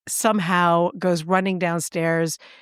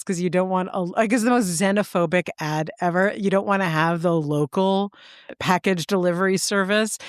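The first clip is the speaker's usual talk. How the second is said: ordinary talk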